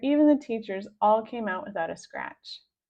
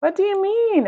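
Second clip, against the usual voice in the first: startled question; 11 semitones higher, 10 dB louder than usual. A narrator's reading. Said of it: "puzzled question".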